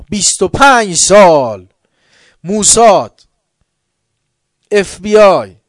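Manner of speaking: loud, high impassioned sermon; fiery preaching. Acted. Preacher thundering to the congregation, impassioned. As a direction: preach it at full force.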